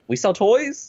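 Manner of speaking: high-pitched, unsure toy seller's pitch; imagined toy store. Acted. A big toy store pleading with shoppers, high questioning pitch. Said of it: "like Toys R Us to really just be like".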